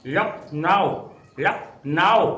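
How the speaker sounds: लखनऊ in two drawn-out chunks, "lak... naao"; English-style pronunciation. Shown as a demonstration. Teacher imitates how the English heard the name, लखनऊ sounded out as "lak-naao". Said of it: "उनके pronunciation सही तरीके से".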